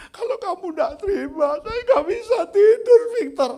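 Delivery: put-on high, loud childlike wailing; crying like a child. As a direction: whine and cry like a little kid. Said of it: "Kayak anak kecil".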